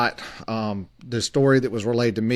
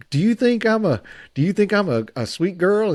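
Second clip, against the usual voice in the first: put-on high, sing-song Georgia girl voice, much higher; mock Georgia belle; 10 semitones higher than usual. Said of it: "she's got this Georgia accent".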